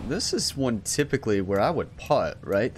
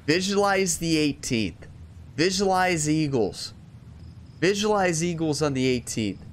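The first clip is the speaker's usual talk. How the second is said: motivational coach; 5 semitones higher than usual. Retold or imitated. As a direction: preach it like a pep-talk coach. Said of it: "Jerry told Ryan".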